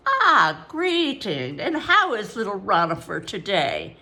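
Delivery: ingratiating servant, piping like a cheap flute; oily Babylonian porter. A narrator's reading. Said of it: "His voice was like the sound of a badly made flute".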